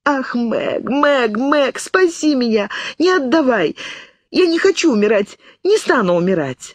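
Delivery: put-on high, crying outburst like a child; girl sobbing and crying out nonsense in despair; sobbing hysterically. A narrator's reading. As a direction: wail it out like a frightened child. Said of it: "Она рыдала, выкрикивая бессмыслицу".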